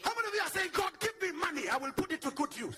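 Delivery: loud, high preacher's call to the crowd; rousing shout. Acted. Preacher shouting to the congregation, loud and high.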